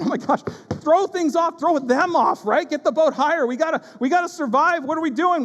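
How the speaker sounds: mock panic, throwing cargo overboard; panicked disciples. Disciples scrambling in the storm, mock panic.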